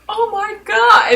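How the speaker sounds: slow, very high-pitched wail; hammed-up distressed squeal; horrified reaction. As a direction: draw it out in mock horror. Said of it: "I would be like".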